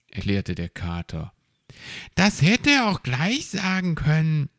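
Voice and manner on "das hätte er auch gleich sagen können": high-pitched little grumbler; miffed, pitched well up; grumbling. A narrator's reading. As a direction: complain in a high creature voice. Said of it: "murrte Borstel".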